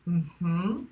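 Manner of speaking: closed-mouth rising hum; hummed assent with upward lilt; allowing mm-hmm. Shown as a demonstration. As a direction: demonstrate a tolerant "mm-hmm", lifting at the end.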